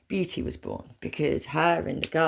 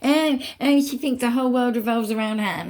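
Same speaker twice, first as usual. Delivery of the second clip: higher, louder mocking sneer; mocking critics; 5 semitones higher, 4 dB louder than usual. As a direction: mimic the haters with scorn. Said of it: "you can say about me what you like".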